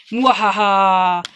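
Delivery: slow, loud evil cackle; mad scientist. Pretend. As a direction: cackle like a villain plotting. Villain laugh at Death card.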